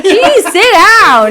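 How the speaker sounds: high, loud, drawn-out put-down; hammed-up exasperated dismissal; mock exasperation. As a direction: stretch out an exaggerated scolding. Acting out scolding a show-off.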